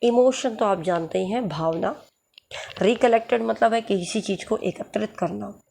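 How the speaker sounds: ordinary talk, nothing marked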